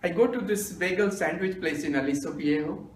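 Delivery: usual speaking voice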